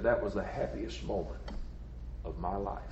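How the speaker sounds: hushed and low, fading away; softly savoring a treasured memory; tender, moved. Acted. Tender climax of retold childhood memory.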